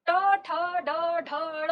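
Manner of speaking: "ट, ठ, ड, ढ, ण" with the tongue flicked down from the roof, loud start; reciting consonants. Shown as a demonstration. Teacher showing the retroflex row: each sound crisp. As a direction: snap out "ट, ठ, ड, ढ, ण" one by one. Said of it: "देखिए".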